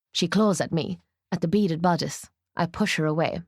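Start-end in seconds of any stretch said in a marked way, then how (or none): none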